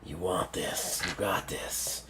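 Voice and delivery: low, very soft villain warning; deep, hushed threat from a creature sure of itself; menacing octopus. Acted. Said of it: "He's like".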